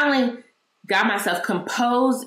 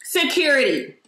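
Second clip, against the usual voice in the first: raised, higher voice, pressing every detail; retold yelling; 5 semitones higher than usual. Shopper pointing out the man to the store, raised retold yelling. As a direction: call him out for everyone to hear. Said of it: "I'm still yelling".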